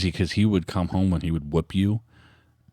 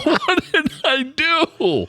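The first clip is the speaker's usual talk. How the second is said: laughing confusion; much slower than usual. Retold or imitated. Confused kid, retold.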